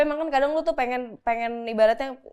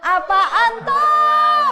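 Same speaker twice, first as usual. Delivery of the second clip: very slow, high, loud "Apaan tuh?"; drawn-out shout; 9 dB louder, much slower than usual. Pretend. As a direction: stretch it into a long playful yell. Teasing the pseudoscience term.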